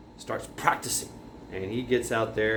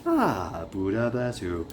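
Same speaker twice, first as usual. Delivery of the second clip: lightly voiced old master; 4 semitones lower, slower than usual. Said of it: "comes out and goes".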